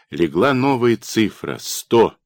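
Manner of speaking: ordinary delivery, nothing marked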